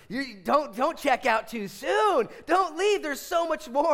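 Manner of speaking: high, loud, slow shout to stay; urgent plea. Retold or imitated. Father shouting to his son leaving the movie early. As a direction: call him back before he leaves. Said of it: "I was like".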